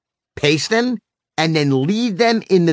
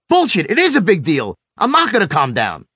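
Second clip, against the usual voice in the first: raised-pitch defiant retort; imitated madder son; 3 semitones higher than usual. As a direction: snap back like an angry kid refusing orders. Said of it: "he'd get even madder".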